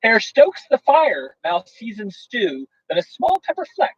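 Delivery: lively, sing-song read-aloud of a rhyming book; storybook reading. A narrator's reading. Host reading a bedtime story to viewers, sing-song.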